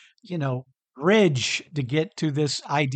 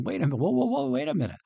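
rising, higher alarmed wait a minute; mock protest; 8 semitones higher, much faster than usual. Listeners balking at the lesson, a higher mock protest. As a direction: play the startled listener objecting. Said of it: "many of you who listen to my voice are going".

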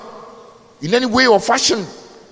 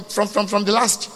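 ordinary delivery

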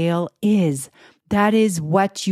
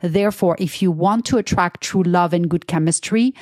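normal talking voice

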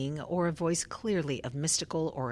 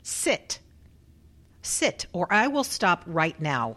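raised, firm order to sit down; stern command; 5 semitones higher, 7 dB louder than usual. Acted. Researcher ordering the subject back into her chair, raised and firm. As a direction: insist she stays seated.

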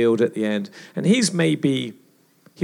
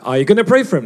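louder and higher, pressing the question; insistent inner prompt; 6 semitones higher, 10 dB louder, faster than usual. Retold or imitated. Holy Spirit nagging the preacher to pray for his teammate, loud and insistent. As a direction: push it like a voice that won't be ignored. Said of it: "And, uh".

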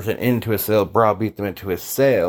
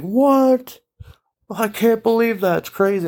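higher, jumping up in disbelief; retold brother who can't believe it; high astonished what, then settling; amazed disbelief; 12 semitones higher than usual. Brother stunned by the sales close.

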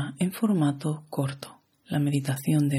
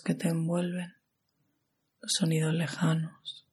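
guided meditation; 2 semitones higher than usual. Acted. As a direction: guide the listener into relaxation, unhurried and even.

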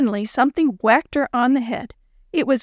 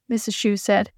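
usual speaking voice

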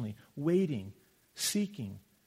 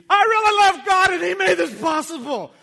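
much higher, loud, hammed-up declaration; mock cringey proclamation; 12 dB louder than usual. Mocking a coach gushing on national TV, hammed-up loud. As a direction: overdo it like an awkward TV testimony. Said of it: "It wasn't like".

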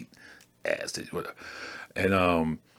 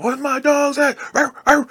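[name]. Retold or imitated. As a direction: bark it out like [name] on stage. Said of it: "All of a sudden, D M X comes out".

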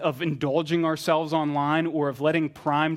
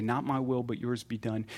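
quiet surrender; 4 semitones lower, 6 dB quieter than usual. Acted. Jesus praying to God in the garden, quietly submitting. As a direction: lower and softer, sinking at the end. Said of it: "we hear Jesus again say".

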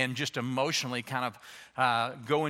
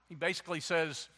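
usual speaking voice

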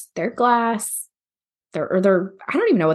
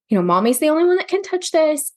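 put-on high, raised warning to a toddler; firm mom warning; 8 semitones higher than usual. Mom warning her toddler not to touch, raised and firm. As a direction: lay down the rule for a two-year-old.

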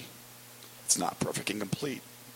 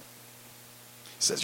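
ordinary talk, nothing marked

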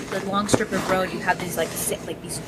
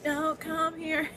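high, slow, eerie voice telling it to stay away; spooky warning; 8 semitones higher, 7 dB quieter, much slower than usual. Real, not acted. Speaking to the unseen presence in the room, slow eerie high warning. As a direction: draw it out high and slow like a haunting.

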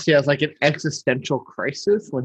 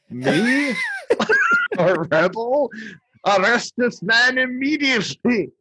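squeaking way up, then high indignant order; a hammed-up shrieking tyrant; over-the-top Burgermeister; 9 semitones higher, slower than usual. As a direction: shriek at the insult, then command. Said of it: "over the top the Burgermeister is".

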